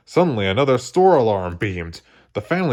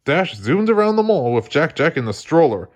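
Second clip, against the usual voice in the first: plain reading voice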